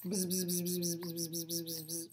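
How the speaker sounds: low, soft buzzing; mower buzz. Acted. Lawnmower buzzing low around Walt. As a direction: drone like a distant mower.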